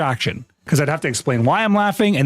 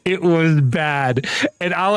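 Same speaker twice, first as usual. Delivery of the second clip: usual speaking voice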